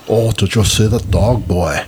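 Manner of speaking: bad German accent attempt; weak stab at a German accent; failed German accent. Shown as a demonstration. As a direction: try a German accent and miss. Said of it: "my attempt at a German accent that failed".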